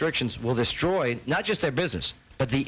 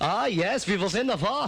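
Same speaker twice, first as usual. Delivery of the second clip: a light, hammed-up German accent, higher; mock German accent; 7 semitones higher than usual. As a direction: mimic a German voice right back at her. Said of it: "I'd be doing some German accent".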